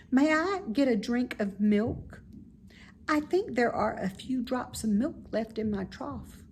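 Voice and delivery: put-on bright childlike pig, fond of the barn; high, eager young pig; young pig Wilbur. Wilbur voiced higher.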